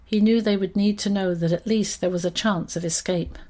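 ordinary delivery, nothing marked

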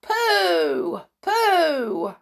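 very high, loud disgusted "Pooh!"; crowd cry. Acted. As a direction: squeal "Pooh!" in glee. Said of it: "cried the audience".

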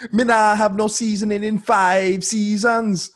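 high mock Jamaican patois; Jamaican accent. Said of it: "from Jamaica".

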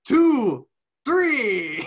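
high, loud count in a mock Scottish accent; Drew McIntyre. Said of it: "Irish, and Scottish".

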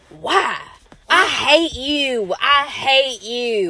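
slow, stretched mock Southern drawl; Emma. Friend imitating her co-host's talk, slow exaggerated Southern impression. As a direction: tease her Southern twang, drawing every word out. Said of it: "this is how Emma talks".

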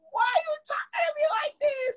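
high, tearful cry of disbelief; upset crying outburst. Retold or imitated. Guest reliving the doctor's grim warning, crying out. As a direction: wail it like the news just hit you. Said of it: "And I'm like".

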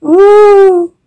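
very high squealing "Ooh". A narrator's reading. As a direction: let out a shrill little squeal. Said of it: "She squeals".

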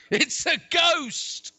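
loud, high, drawn-out cry of alarm; terrified cry. A narrator's reading. Disciples spotting a figure on the sea, terrified shout. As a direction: cry out in dramatized terror. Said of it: "they screamed in terror".

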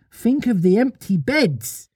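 high, lilting little-mother storyteller; childlike storytelling voice; Wendy telling a story. Acted. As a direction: pitch it up, telling children a tale.

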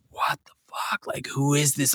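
whispered disbelief under the breath; a quiet, incredulous whisper behind his back; hushed aside. Retold or imitated. Band judging the drummer behind him, whispered disbelief.

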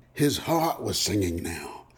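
plain reading voice

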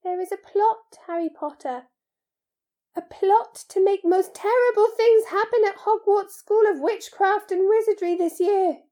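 high, urgent creature voice, not whispered; fearful elf. A narrator's reading. House-elf reveals a plot to Harry, fearful. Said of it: "whispered Dobby, suddenly trembling all over".

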